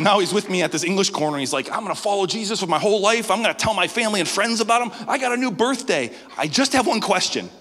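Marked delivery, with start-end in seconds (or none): 1.7-7.5 higher, bright, bursting; eager new believer. Retold or imitated. Ren telling the pastor his plans, high and eager. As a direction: rattle it off full of excitement. Ren's exuberant report.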